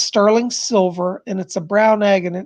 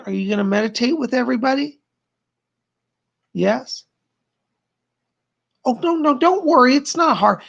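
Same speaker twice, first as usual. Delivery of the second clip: higher, sing-song coaxing to a pet; 4 semitones higher than usual. Real, not acted. Coaxing Lamb Chop to meditate with them, higher sing-song baby talk. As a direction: coax her little companion along sweetly.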